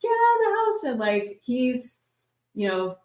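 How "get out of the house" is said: high-pitched mock scream, stretched out; retold scream. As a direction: imitate screaming at a housemate to escape. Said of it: "I'm screaming at Merrick".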